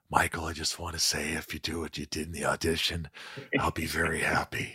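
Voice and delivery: low, soft, understated tough-guy voice; hushed star-director impression; Clint Eastwood. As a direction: keep it quiet and low, barely projecting. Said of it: "listen to you doing Clint all day long".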